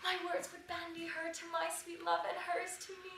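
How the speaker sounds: ordinary talk, nothing marked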